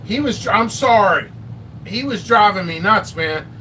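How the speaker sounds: usual speaking voice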